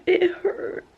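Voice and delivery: high, sobbing, the words breaking; real crying. Real, not acted. Reader sobbing over the book that tore her up, real crying. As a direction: let the sob push the words up high and slow. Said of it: "This book is literally tearing me up".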